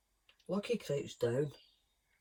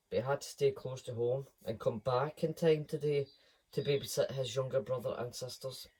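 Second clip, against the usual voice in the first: ordinary delivery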